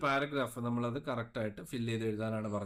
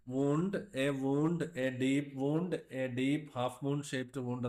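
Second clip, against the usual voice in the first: slow dictation; much slower than usual. Shown as a demonstration. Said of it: "expand ചെയ്ത് എഴുതിക്കൂടെ?".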